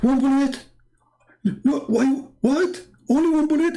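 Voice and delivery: he can't believe only one bullet; shocked disbelief. Retold or imitated.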